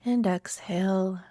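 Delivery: unhurried meditation guide with long pauses; calm, spacious meditation voice; guided meditation. Acted. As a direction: lead listeners gently inward, leaving room to breathe.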